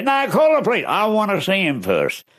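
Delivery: high, insistent back-and-forth; husband and wife bickering; couple bickering. Retold or imitated. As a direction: argue over who sees him first. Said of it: "couple arguing".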